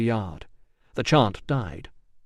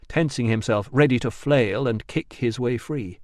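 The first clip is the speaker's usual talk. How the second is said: ordinary delivery, nothing marked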